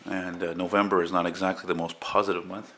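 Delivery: normal talking voice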